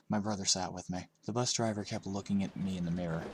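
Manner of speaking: ordinary delivery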